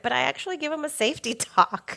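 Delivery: laughing through the end of the line, quick. Real, not acted. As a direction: giggle while confessing it. Laughing admitting secret safety talk.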